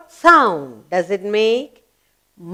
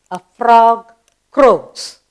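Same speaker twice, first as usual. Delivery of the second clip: slow spelling-lesson drill; 5 dB louder, much slower than usual. Shown as a demonstration. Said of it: "Spell croaks".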